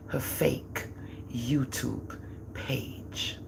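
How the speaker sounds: hushed, slow secret; conspiratorial whisper. Real, not acted. Telling viewers about her daughter's fake page, whispered. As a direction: whisper it like a confession.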